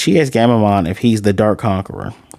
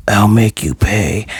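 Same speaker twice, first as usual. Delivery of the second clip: menacing threat; slower than usual. Retold or imitated. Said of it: "his tone shifted".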